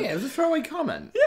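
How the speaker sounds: high, quick laughing echo; laughing mock excuse. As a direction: scoff it through a laugh. Mocking the throwaway excuse.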